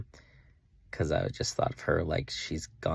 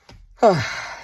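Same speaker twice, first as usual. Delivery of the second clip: loud heavy exhale; heavy sigh; 13 semitones higher, 11 dB louder than usual. Real, not acted. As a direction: let out a big sigh. Emotional pause after recounting crying.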